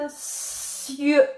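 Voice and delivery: "cieux" with a long hissed opening "s", high; syllable stretched out. Shown as a demonstration. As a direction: draw out the sound at the start of "cieux" very slowly. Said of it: "deuxième syllabe".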